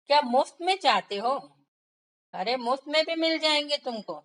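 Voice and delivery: fed-up seller throwing it back at him; annoyed. Acted. Annoyed seller mocking the miser who wants coconuts free. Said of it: "अब उसको आ गया गुस्सा".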